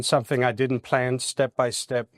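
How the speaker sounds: ordinary talk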